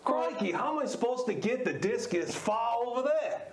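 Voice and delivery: hammed-up Australian accent; Aussie accent. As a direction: play it as a comic Aussie. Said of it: "Give us an Aussie accent".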